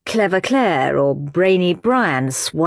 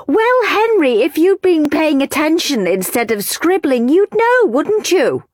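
high, sarcastic teacher voice; strict teacher; 10 semitones higher than usual. Acted. Teacher mocking Henry for scribbling, sarcastic. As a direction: talk down to him like a stern schoolmistress. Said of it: "said Miss Battleaxe".

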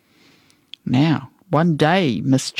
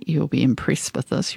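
usual speaking voice

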